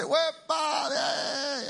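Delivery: high shouted Puerto Rican cheer; high festive yell; party shout. Retold or imitated. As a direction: yell it like the whole apartment is celebrating. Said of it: "And they say".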